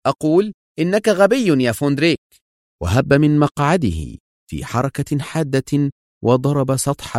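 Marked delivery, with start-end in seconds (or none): 0.0-2.2 high-pitched angry insistence; irritated, cutting emphasis; sharp. A narrator's reading. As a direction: repeat the insult sharply. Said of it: "وهو يقول في حدة".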